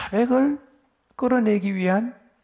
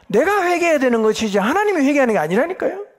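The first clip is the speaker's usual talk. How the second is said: louder and higher, pressing the point home; emphatic insistence; 8 semitones higher, 6 dB louder than usual. Real, not acted. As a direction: drive it home to the congregation. (높고 크게 힘주어 강조)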